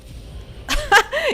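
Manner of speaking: squealing rising laugh; high laugh. Real, not acted. Streamer laughs as a huge club ganks them, squealing. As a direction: laugh in disbelief.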